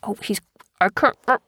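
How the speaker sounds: usual speaking voice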